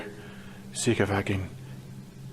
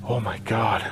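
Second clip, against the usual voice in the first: breathless, frightened whisper; scared whisper; 4 semitones lower, 3 dB quieter than usual. Real, not acted. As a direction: gasp it under your breath. Heard footsteps, heart racing.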